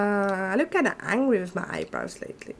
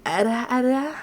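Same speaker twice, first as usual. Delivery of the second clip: ara ara tease; 4 semitones higher than usual. Shown as a demonstration. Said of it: "I need to train the ara, aras".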